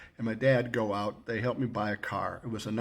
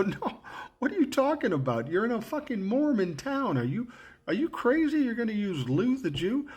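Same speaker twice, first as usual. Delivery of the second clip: incredulous outburst; 11 semitones higher than usual. Retold or imitated. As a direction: tell him he's lost his mind. Arguing with roommate over name.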